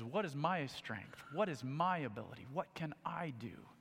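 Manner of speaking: whispered self-doubt; a hushed, doubting whisper. Retold or imitated. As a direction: breathe it out, unsure of himself. Peter's doubting inner voice.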